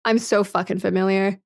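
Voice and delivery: ordinary talk, nothing marked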